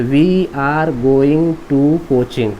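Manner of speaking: very slow, word by word; slow dictation. Shown as a demonstration. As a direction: dictate the finished sentence, stretching each word. Teacher dictating the finished translation. (धीरे-धीरे, एक-एक शब्द अलग करके)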